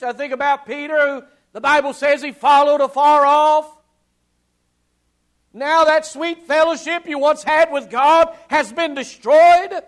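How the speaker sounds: loud, high, impassioned pulpit voice; full-force preaching. Acted. Preacher thundering to the congregation, full-force preaching. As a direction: preach it out to the back pews.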